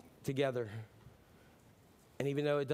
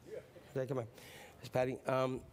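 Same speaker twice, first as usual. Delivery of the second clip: normal talking voice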